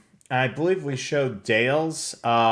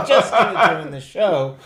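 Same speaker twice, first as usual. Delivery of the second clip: laughing through the words, louder; laughing, caught editing slides mid-show; 7 semitones higher, 9 dB louder than usual. Real, not acted. Cohost teases him, he repeats it laughing.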